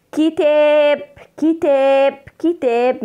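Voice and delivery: "كتاب" with the alif drawn out on a level note; stretched long vowel. Shown as a demonstration. Teacher showing pupils the madd, lengthened aa in "كتاب". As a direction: hold the aa of "كتاب" long and strong. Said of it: "مد بالألف".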